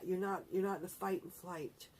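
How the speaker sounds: ordinary talk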